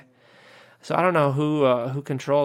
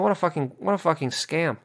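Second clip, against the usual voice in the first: ordinary delivery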